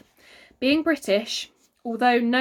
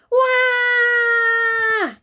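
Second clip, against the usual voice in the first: high, loud bawling wail; loud crying wail; 13 semitones higher, 7 dB louder than usual. A narrator's reading. Myrtle bawls at the checkout, wailing loudly. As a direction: wail like a toddler in tantrum. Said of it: "wailed Myrtle".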